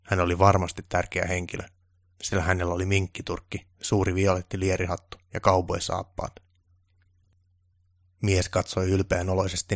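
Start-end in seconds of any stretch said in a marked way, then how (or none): none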